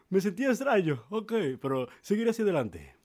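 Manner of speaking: high, loud call back; shouted reply. Acted. Mario reassuring housemate from afar, high shouting. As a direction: holler it back across the field.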